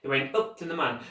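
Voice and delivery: ordinary delivery, nothing marked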